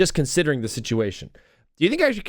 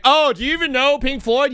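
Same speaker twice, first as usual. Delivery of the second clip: high, snooty mock fan voice; mocking gatekeeper; 10 semitones higher, 4 dB louder than usual. Mocking fans who quiz his shirt, high snooty voice. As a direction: sneer like a band snob. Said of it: "You're like".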